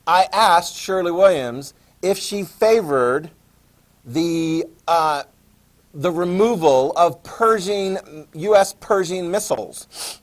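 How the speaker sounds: standing up in a town hall and asking in an exaggerated American accent; American accent played to the room. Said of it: "And in an American accent".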